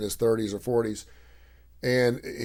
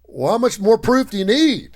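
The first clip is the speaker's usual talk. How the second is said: higher, louder and slowed-down puzzled question; 10 semitones higher, 7 dB louder than usual. Retold or imitated. A worker asks the girl about proof, loud, slow and puzzled. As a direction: press her, honestly baffled. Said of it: "worker questions unvaccinated girl".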